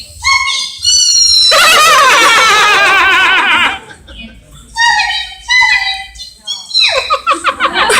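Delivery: long, very high, loud squeal; cartoon character squeal. Shown as a demonstration. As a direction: let out a piercing sustained cartoon squeal. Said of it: "so I can do".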